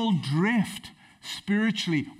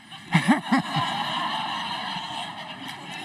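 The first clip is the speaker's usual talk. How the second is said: laughing. Real, not acted. Laughing at own joke.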